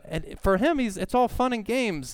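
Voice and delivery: usual speaking voice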